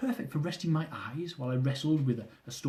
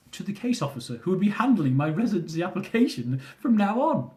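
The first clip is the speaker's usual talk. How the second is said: plain reading voice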